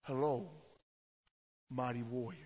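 low, soft greeting to a warrior; angel's greeting. Retold or imitated. Angel of the Lord greeting Gideon, low soft greeting. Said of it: "and he says".

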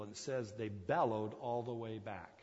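ordinary delivery

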